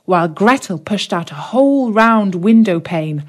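plain reading voice

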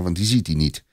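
plain reading voice

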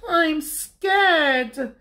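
high, frightened little cub voice; Little Bear. A narrator's reading. Little Bear can't sleep and tells Big Bear, high scared cub voice.

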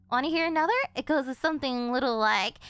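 usual speaking voice

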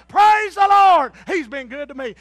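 high, loud, slow shout of praise; hollering praise. Retold or imitated. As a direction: holler it with all your joy. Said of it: "I'd holler".

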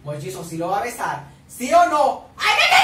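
high, loud rising screams; excited screaming. Real, not acted. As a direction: scream as the kiss is coming. Fan shouts as kiss nears.

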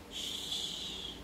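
very soft, long hush; faint drawn-out shush. Acted. Rascal falls quiet for a moment, soft shush. Hushing after being quiet.

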